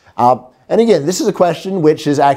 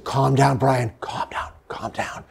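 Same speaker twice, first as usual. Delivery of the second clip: soft self-soothing, fading down to a whisper; calming self-talk; 9 dB quieter, slower than usual. Retold or imitated. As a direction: talk yourself down quietly until it's barely breath. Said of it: "I said".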